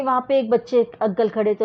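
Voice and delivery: usual speaking voice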